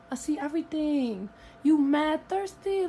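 ordinary talk

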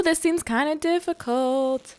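normal talking voice

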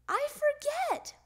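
high-pitched helpless admission; little frog at a loss; childish excuse. A narrator's reading. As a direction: pipe it up like a forgetful child. Child excuses himself to father.

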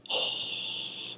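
long, soft exhale; weary sigh. Real, not acted. Tutorial maker facing a redo, long weary sigh. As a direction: let out a long, resigned breath.

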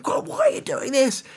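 high, bewildered cry to God; pleading in confusion; wife crying out to God, bewildered; bewildered plea. Retold or imitated. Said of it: "and she goes".

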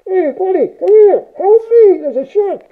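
very high, loud muffled call for help; hammed-up underwater cry through a mouthpiece; mock panicked call. Shown as a demonstration. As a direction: yell to your buddy that a shark is coming. Demoing underwater shout for help.

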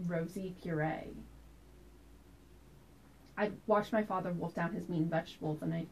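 soft, measured storytelling with reflective pauses; dramatic monologue. Acted. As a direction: recount a childhood memory as a quiet stage monologue. Performs a memory monologue.

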